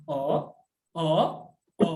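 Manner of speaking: O as a rising Mandarin second tone. Shown as a demonstration. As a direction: lift O upward like a realizing "oh?". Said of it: "nada dua itu".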